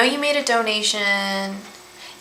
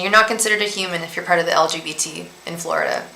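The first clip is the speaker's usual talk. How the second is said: ordinary delivery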